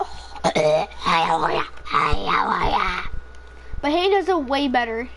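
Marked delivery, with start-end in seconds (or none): 0.0-1.6 much lower, put-on cartoon voice. Shown as a demonstration. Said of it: "I can somewhat do it". 1.8-3.0 low, slow, strained put-on voice. Shown as a demonstration. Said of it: "I can somewhat do it".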